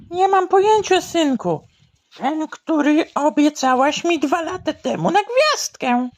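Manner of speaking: high character voice; high put-on voice. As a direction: answer in a high squeaky voice. Mother and boy exchange.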